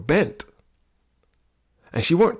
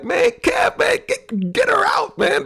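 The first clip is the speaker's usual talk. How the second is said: high, strained, panicked plea, much higher; panicked linemen; slower than usual. Retold or imitated. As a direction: beg for help in a high, strained voice. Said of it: "they looked back and be like".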